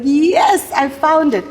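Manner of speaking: high, loud triumphant yes; confident triumph. Retold or imitated. Actress recalling finding her love, confident and triumphant. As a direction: re-enact a sure, exultant discovery. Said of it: "I was so confident".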